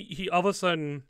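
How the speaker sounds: normal talking voice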